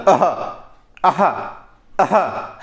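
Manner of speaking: आहा breathed out from the throat with a clear final h; visarga from the throat. Shown as a demonstration. Teacher demonstrates the visarga sound from the throat. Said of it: "जैसे आहा बोल के देखिएगा".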